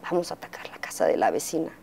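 susurrado y rápido, en secreto; susurro cómplice. Retold or imitated. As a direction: cuchichea la travesura. Secreto con la hija. (madre a su hija planeando la venganza, susurrando)

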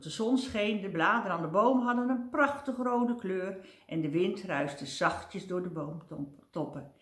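ordinary delivery

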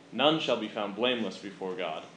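ordinary delivery

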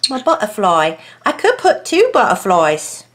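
playful put-on English accent. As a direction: slip into a mock English accent. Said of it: "I don't know why I have an English accent".

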